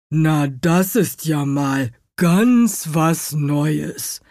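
slow, drawling grumpy beetle voice; grumbling stag beetle. A narrator's reading. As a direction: grumble it slowly like a woken old beetle. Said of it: "brummte der Hirschkäfer".

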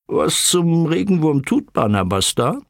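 puzzled, pitch lifted; baffled question. A narrator's reading. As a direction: ask it in bewilderment. Uncle questions boy's odd swinging.

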